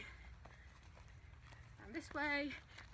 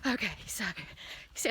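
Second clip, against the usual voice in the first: out of breath; 3 semitones lower, 9 dB louder than usual. Real, not acted. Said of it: "I'm already starting to get a little bit out of breath".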